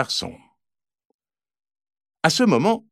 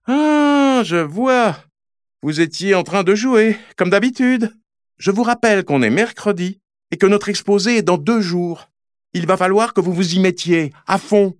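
scolding exclaim; 6 semitones higher than usual. Acted. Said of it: "Olympe s'exclame".

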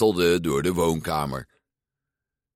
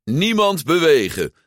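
higher, louder hammed-up villain command; gruff villain order; 8 semitones higher, 5 dB louder than usual. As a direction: bark the order like a toy bandit. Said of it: "met een barse stem".

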